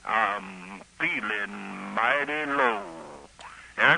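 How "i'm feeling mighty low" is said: lightly voiced gloomy radio character; a hint of a mournful old-radio catchphrase; mighty low. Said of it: "And he'd say".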